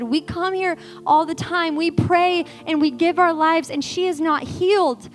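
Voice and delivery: breaking down. Retold or imitated. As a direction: pour out the grief, rising. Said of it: "I'm just breaking down".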